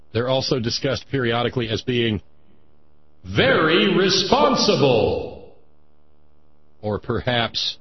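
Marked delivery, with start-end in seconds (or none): 3.2-5.5 slow, loud mock praise; sarcastic. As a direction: sneer it out with irony. Mocking the pundit's praise.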